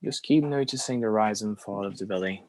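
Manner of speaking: unhurried phrases with very long silences; guided meditation. Acted. Teacher leading a group through belly breathing, guided meditation. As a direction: guide each breath, leaving room to follow.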